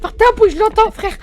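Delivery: loud hush. Real, not acted. Hiding, hushing teammate loudly.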